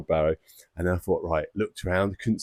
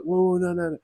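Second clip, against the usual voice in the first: alarmed stop; 11 semitones higher, faster than usual. Retold or imitated. Dad trying to stop his son fetching scissors, high and alarmed. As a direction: panic to stop him in time.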